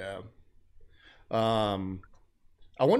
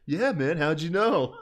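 stoner Riddle; 6 semitones higher, 4 dB louder than usual. Retold or imitated. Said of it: "And Riddle was like".

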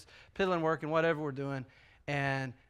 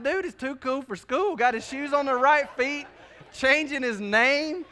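higher, louder, amused marveling; amused disbelief; 7 semitones higher, 4 dB louder than usual. Retold or imitated. Dad marveling to himself at his son acting cool, high and amused. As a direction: marvel at the little show-off. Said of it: "I'm like".

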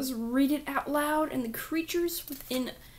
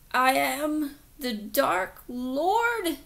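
put-on slow, drawn-out boyish proclamation; boyish Dark Lord; 4 dB louder, much slower than usual. Boy declaring himself Dark Lord to police, slow and solemn. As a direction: announce your title slowly, in a boy's voice. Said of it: "his voice really did sound like ... human boy".

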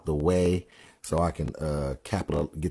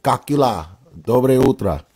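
1 Russian greeting; 5 semitones higher, 7 dB louder than usual. Shown as a demonstration. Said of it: "speak Russian and Ukraine".

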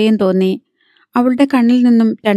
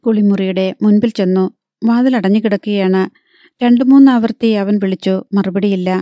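usual speaking voice

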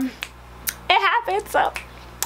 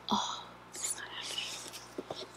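barely audible whisper of disbelief; disbelieving whisper; 19 dB quieter than usual. Real, not acted. Vlogger stunned by the new laptop, faint whisper.

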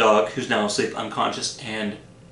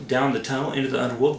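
plain reading voice

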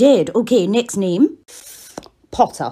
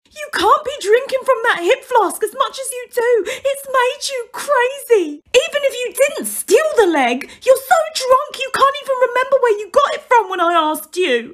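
high lecturing voice; 12 semitones higher than usual. Acted. Lecturing drunk Moody.

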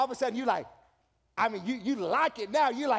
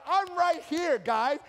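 mock exasperation; 7 semitones higher, 6 dB louder, slower than usual. Mock Jesus aside.

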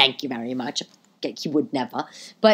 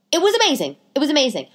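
amazing said much higher and thrilled; excited higher rave; gushing; 8 semitones higher, faster than usual. Real, not acted. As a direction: rave about it, pitch soaring. Repeated praise, building.

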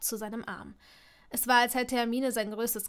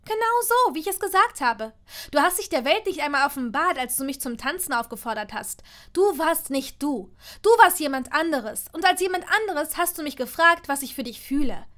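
loud, raised, angry voice; furious accusation; 4 semitones higher, 9 dB louder than usual. A narrator's reading. Hermine reproaches him for the ball, furious and loud. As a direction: hammer each accusation at him. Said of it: "Hermine sah ihn weiterhin wütend an".

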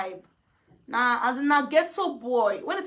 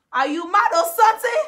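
loud, high mocking yell; rising mocking shout; mock catchphrase; 7 semitones higher, 9 dB louder than usual. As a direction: fling it out like a taunt.

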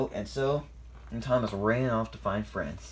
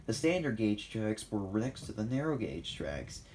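usual speaking voice

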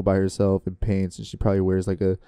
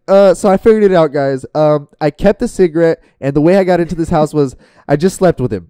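higher, louder put-on Lana voice; Lana as video host; 7 semitones higher, 8 dB louder than usual. Lana telling her viewers about the house, high put-on voice. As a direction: address the viewers as Lana, pitched up and loud. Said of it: "She's like".

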